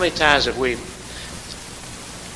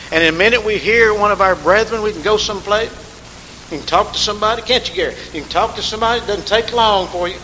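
higher, louder preacher drive; fervent preaching; 5 semitones higher, 5 dB louder, faster than usual. Acted. Preaching at full force.

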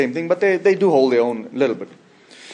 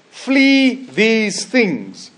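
FLEE THESE THINGS drawn out very slowly, pitched high; "flee these things" as a slow, high, ringing exhortation; emphatic scripture command; 8 semitones higher, much slower than usual. Acted. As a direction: stretch each word of "flee these things" high and long.

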